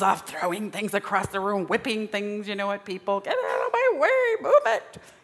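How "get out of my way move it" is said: much higher, louder shoving yell; frantic inner rage. Acted. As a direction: bark it while tearing through the house. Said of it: "inside I'm like".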